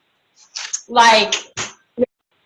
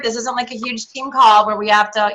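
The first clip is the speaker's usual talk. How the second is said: normal talking voice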